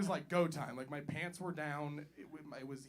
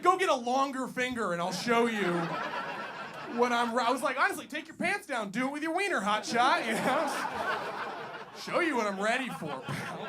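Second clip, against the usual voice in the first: mock challenge; 7 semitones higher, 8 dB louder than usual. Retold challenge to the doctor.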